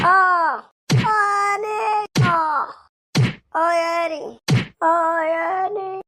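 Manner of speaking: high, drawn-out groans of pain. Acted. Beaten partner groaning under the blows. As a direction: moan slowly as the blows land.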